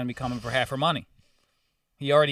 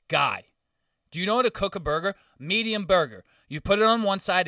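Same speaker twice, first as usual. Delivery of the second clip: condescending lecture; 5 semitones higher, 4 dB louder than usual. Retold or imitated. Customer lectures waiter condescendingly.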